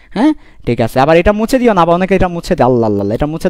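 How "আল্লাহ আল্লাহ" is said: ordinary delivery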